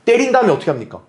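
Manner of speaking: usual speaking voice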